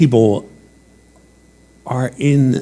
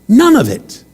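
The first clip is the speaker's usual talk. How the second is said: raised, emphatic sermon line; preaching; 13 semitones higher, 6 dB louder than usual. Acted. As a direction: drive the point home from the pulpit. Preacher insisting.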